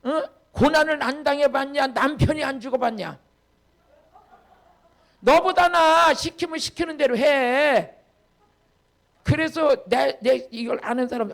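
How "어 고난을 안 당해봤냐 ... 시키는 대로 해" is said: mock-indignant outburst, stretched at the end; indignant retort. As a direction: tell them off forcefully. Acted indignant retort, bossing someone.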